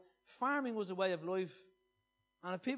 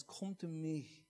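gentle scripture reading; 4 semitones lower, 5 dB quieter than usual. Acted. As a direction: read it like a quiet invitation to rest. Reads scripture over music.

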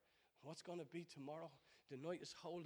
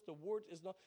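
normal talking voice

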